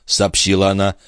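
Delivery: plain reading voice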